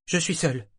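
Yémin answering sharply; curt. A narrator's reading. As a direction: cut him off coldly. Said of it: "d'un ton cassant".

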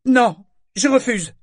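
high, loud, distinct no; clear, firm refusal. A narrator's reading. Boy refuses before the whole crowd, clear and firm. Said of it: "déclara Yémin d'une voix nette".

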